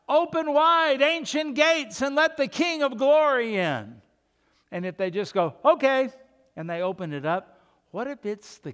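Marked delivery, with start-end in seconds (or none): 0.1-4.0 loud high proclamation; a herald crying out to open the ancient gates. Acted. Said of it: "if somebody cried out". 5.6-6.1 careless okay. Acted. Careless gatekeeper agreeing.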